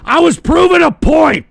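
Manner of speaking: loud, high, indignant yell; shouting back at the chatter who mocked him; defensive shout. Real, not acted. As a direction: bark it back in self-defence. Snapping back at a chat insult.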